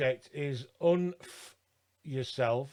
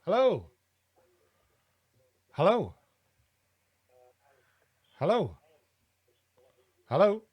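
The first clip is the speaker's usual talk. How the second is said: shouting into a phone; 2 semitones higher than usual. Real, not acted. Calling into a silent phone line.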